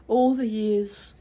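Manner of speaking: ordinary delivery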